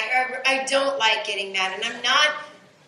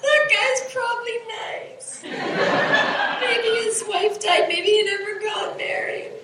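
hysterical laughing; 10 semitones higher than usual. Real, not acted. Manic emotional overflow, laughing-crying.

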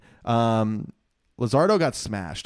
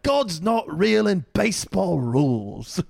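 slow, higher mock British comedian; drawn-out British celebrity impression; Ricky Gervais; 6 semitones higher, slower than usual. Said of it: "Ricky Gervais or someone".

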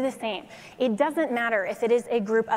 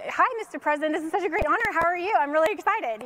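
higher, gushing excited fan voice; fangirling; 6 semitones higher, faster than usual. Retold or imitated. Young attorney gushing to the president on the phone, giddy and higher. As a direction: gush at the president like a starstruck fan. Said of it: "totally fangirling".

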